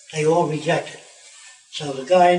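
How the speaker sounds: ordinary delivery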